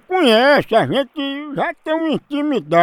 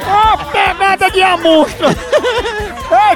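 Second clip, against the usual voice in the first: laughing; 9 semitones higher, much slower than usual. Real, not acted. Host laughing after the call.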